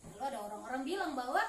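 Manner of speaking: ordinary talk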